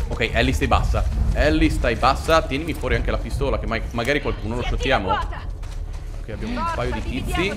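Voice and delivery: usual speaking voice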